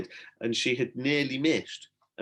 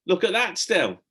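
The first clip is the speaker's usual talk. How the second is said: excited; 9 semitones higher, 5 dB louder than usual. A narrator's reading. Tom spots something on lake.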